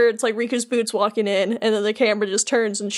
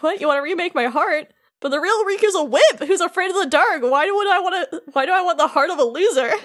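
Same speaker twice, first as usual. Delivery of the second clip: high, indignant mock protest; dramatic disbelief; 6 semitones higher than usual. Replica Riku objecting to having his heart remade, dramatic disbelief. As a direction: play up the affronted shock, pitch climbing. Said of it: "a dramatic gasp where he's like".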